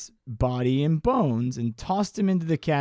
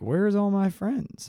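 higher, slow mock-forlorn question; lone goat calling; 6 semitones higher, slower than usual. Goat at the hilltop seeking friends, lightly acted. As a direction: lightly act a puzzled goat. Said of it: "been like".

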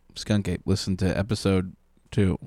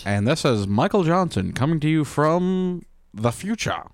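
put-on old-time radio announcer, pitched up; Michael Johnson; 5 semitones higher than usual. Co-host reporting from the future, mock old-time announcer voice. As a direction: announce it like a mock twenties broadcast. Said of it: "Nineteen twenties future".

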